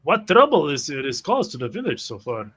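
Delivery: ordinary delivery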